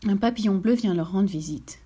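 plain reading voice

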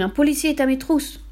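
high-pitched, urgent cry. A narrator's reading. Brigand chief tells librarian police are after him, crying out. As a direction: cry out like a hunted man. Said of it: "cria-t-il".

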